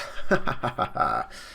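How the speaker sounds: acted crew belly laugh, falling away; comic group-style cackle; hearty laugh. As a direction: laugh out a run of ha-has after the joke. Crew laughs at own joke.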